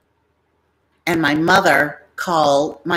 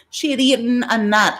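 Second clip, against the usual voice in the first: ordinary talk, nothing marked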